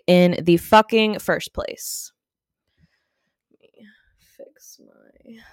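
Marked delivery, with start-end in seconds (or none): 3.5-5.4 whispered aside. Real, not acted. Adjusting mic, muttering aside.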